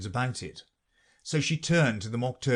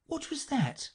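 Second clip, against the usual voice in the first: put-on higher, puzzled girl's question; curious young Alice. Alice questioning the Mock Turtle, puzzled. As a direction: ask it like a curious little girl.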